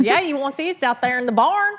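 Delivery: high, loud country uncle voice; uncle's Southern drawl. Retold or imitated. Uncle pointing his niece to the barn in a high loud drawl. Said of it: "he goes".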